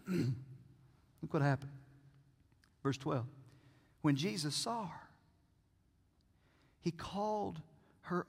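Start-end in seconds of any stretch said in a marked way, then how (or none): none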